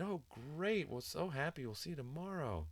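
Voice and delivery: high, swooping, gushing farewell imitation; sing-songy phony voice. Office staff saying goodbye to the child, sing-songy and phony. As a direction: trill it in a fake, high singsong. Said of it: "That, that whole sing-songy, phony voice".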